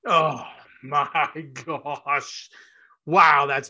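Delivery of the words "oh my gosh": laughing. Real, not acted. Host reacts to the guest's point.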